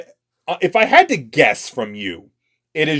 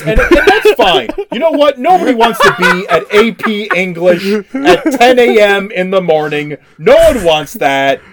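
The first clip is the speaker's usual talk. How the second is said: mock exasperation; 7 semitones higher, 6 dB louder than usual. Real, not acted. Mocking bored AP English class.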